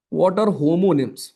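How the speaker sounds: slow, drawn-out question to the class; slow teacher question. Shown as a demonstration. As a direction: pose it slowly, word by word. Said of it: "homonyms क्या होते हैं फिर?". (धीमा सवाल)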